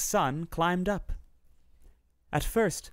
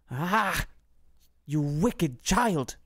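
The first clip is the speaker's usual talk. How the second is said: enchantress crying out; much slower than usual. A narrator's reading. Enchantress to Rapunzel, discovering her secret: slow outraged cry. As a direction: drag out the scolding like a wronged witch. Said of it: "cried the enchantress".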